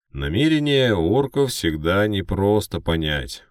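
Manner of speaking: slow, loud broadcast voice; news anchor. Acted. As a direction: pronounce it like a measured TV pundit. Second anchor on TV.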